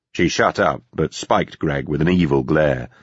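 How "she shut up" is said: ordinary delivery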